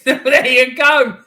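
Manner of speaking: amused, drawn out between laughs; reacting to the joke with a laugh. Real, not acted. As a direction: laugh it out, slow.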